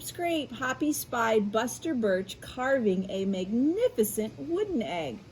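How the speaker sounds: ordinary delivery, nothing marked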